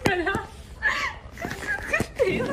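loud, high pleading through tears of laughter; laughing. Real, not acted. Host pleading with Yuzu, crying with laughter. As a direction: beg her to stop while crying laughing. Begging her to stop kukuku.

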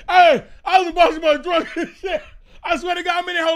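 high, loud words through laughter; laughing hard. Real, not acted. Recalling himself drunk in the shower, laughing hard. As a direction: tell it while cracking up. Cracks up at his drunk self.

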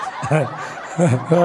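low chuckling laughter; laughing. Real, not acted. Comedian laughing after the grandpa joke, low chuckle. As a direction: crack up at the punchline.

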